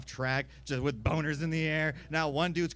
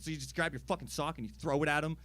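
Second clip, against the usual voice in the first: normal talking voice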